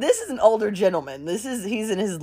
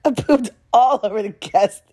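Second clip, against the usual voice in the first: ordinary talk, nothing marked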